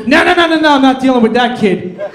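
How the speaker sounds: hammed-up father backing away from the kid; dad refusing. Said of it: "he goes".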